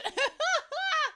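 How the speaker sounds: high burst of laughter; high squealing laugh. Real, not acted. Laughing at the system's retort, high squeal. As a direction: cackle at her own line.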